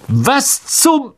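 high, indignant exclamation; broken off in startled outrage; empörte Verblüffung. A narrator's reading. Malfoy handed a stinking sock. (empört und hoch hervorgestoßen)

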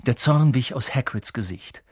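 usual speaking voice

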